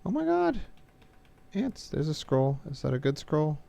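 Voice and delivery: sudden high-pitched panicked yelp; startled, voice jumping way up; panicking gamer. Real, not acted. Sudden scroll amid chasing monsters.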